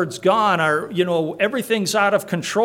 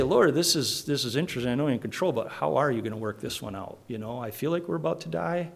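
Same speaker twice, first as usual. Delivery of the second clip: hushed, fast aside to a sleeping Jesus; gentle nudge to the Lord; 4 semitones lower, 6 dB quieter, faster than usual. Pretend. Disciple nudging Jesus in a sinking boat, hushed and fast. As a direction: lean in and murmur it quickly, half joking. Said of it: "nudge him and say".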